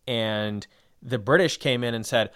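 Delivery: usual speaking voice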